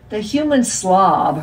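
plain reading voice

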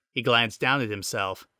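ordinary delivery, nothing marked

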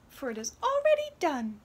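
high, loud wren proclamation; little talking bird. Acted. Wren grants the parents' wish, high bird voice. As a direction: announce it as a magical little bird. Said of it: "the wren said".

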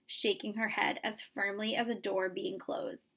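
plain reading voice

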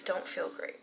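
faint breathy whisper, worn out; exhausted whisper. Real, not acted. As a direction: barely get it out, drained. Sick and exhausted at night.

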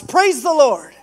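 high, loud call of praise; shouting praise. Acted. Old lady to the atheist next door, shouting praise. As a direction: joyfully call it out from the porch. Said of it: "and she shouts".

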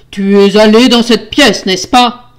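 loud, forceful accusing question; furious accusation. Acted. Husband accusing his wife, furious shout. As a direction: roar the accusation.

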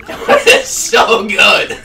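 laughing. Real, not acted. Laughing fit.

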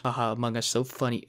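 mock his own joke dryly; sarcastic self-mock. Vlogger mocking his own Among Us pun. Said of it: "mocking own pun".

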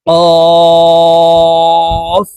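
কফ from the root of the tongue against the palate, held and sung; Arabic letter Qaf. Shown as a demonstration. Teacher showing viewers কফ, long chanted letter. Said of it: "কফের উচ্চারণ জিহ্বার গোড়া ... তালুর সঙ্গে লাগাইয়া উচ্চারণ".

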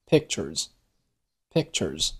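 pictures said twice with the t of pictures held silent, slowly; swallowed final t. Shown as a demonstration. Teacher demonstrating to students, unreleased t in pictures. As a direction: mouth the t in pictures but give it no sound. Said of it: "读成了".

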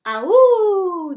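loud, high howling yell; high wolf yell. Acted. Children yelling a howl at the wolf. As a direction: let out a wild howling cry. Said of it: "they yelled".

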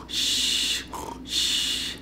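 voiceless soft shh; shushing. Shown as a demonstration. Teacher hushing kids as the animals fall asleep. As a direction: hush them like sleeping animals.

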